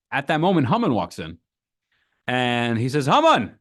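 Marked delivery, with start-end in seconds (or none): 3.1-3.6 a loud, high royal summons; king loudly calling for his minister. Acted. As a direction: bark the name across the hall.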